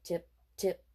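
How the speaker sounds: usual speaking voice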